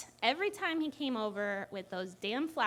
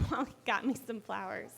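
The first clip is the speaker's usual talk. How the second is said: ordinary delivery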